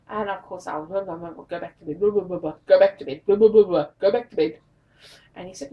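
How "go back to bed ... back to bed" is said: exasperated, loud brush-off repeated; shooing him back to bed, louder each time; mock yell. To her husband, loudly shooing him off to bed.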